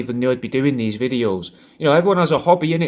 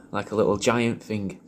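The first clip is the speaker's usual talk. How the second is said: ordinary delivery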